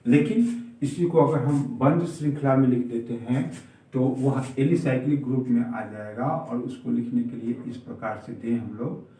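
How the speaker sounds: ordinary talk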